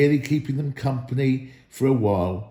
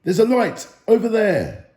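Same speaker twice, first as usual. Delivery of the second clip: high, louder call of discovery; lookout's excited call; 9 semitones higher, 4 dB louder than usual. A narrator's reading. Lookout Balin points out a distant light, excited. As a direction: sing out the sighting, high and bright. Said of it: "when Balin ... said".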